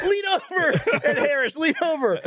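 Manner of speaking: high-pitched laughing orders; mock coaching. Mock-coaching Ed Harris through the visor, laughing. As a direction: coach a drowning man while cracking up.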